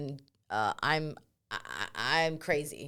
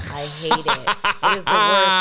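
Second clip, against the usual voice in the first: laughing out the words, louder; 8 dB louder than usual. Real, not acted. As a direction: laugh through the complaint. Laughing about pre-show shits.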